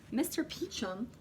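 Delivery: usual speaking voice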